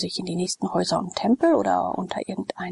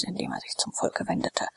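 ordinary delivery, nothing marked

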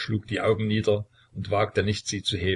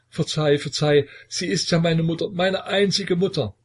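higher, louder begging that climbs; desperate pleading; 8 semitones higher, 4 dB louder than usual. A narrator's reading. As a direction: beg for mercy, pitch rising on each phrase. Son begging at father's feet.

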